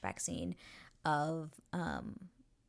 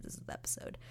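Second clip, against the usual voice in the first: hushed, secretive whisper of "This is the episode."; 9 dB quieter, much faster than usual. Shown as a demonstration. Vlogger teasing viewers about the big episode, whispering. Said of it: "this is the episode when it begins".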